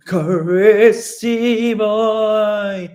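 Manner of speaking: put-on spooky, drawn-out ghost voice rising high on Boy; ghostly voice.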